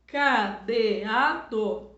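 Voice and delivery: cadeado drawn out, ca-de-a-do; teacher stretching cadeado; slow syllable reading. Shown as a demonstration.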